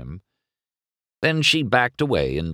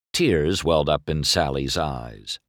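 ordinary delivery, nothing marked

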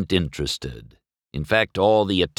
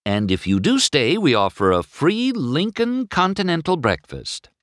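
high-pitched, eager sales offer; helpful; 9 semitones higher than usual. A narrator's reading. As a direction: tempt guests with a perk. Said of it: "Sally told the couple helpfully".

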